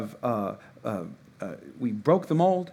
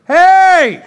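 full-force holler, far higher and louder; yelling; 13 semitones higher, 14 dB louder, much slower than usual. Acted. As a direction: yell upstairs for the kids, stretching the last word. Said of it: "I like to yell".

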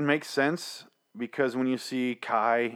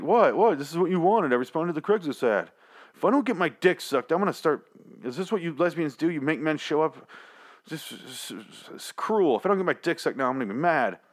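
mock indignant naked man; 7 semitones higher than usual. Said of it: "He's like".

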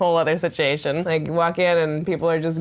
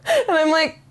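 ordinary delivery

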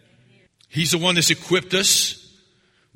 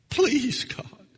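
mock begging; 8 semitones higher, 5 dB quieter than usual. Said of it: "a sophisticated form of begging".

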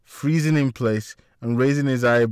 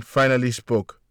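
ordinary delivery, nothing marked

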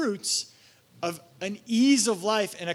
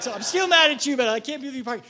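playful fake grudge, a bit louder; mock indignation; 5 semitones higher, 6 dB louder, faster than usual. Pastor jokes he is still mad, with mock indignation. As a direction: tease the other pastor. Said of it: "I was like".

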